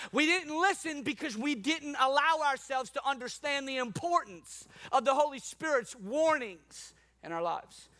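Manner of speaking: usual speaking voice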